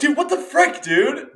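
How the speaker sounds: high squeaky mock outrage; imitating outraged stranger. Stranger whose sandwich was slapped away, high mock outrage. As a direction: play the offended stranger. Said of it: "They're like".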